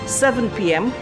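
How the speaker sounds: usual speaking voice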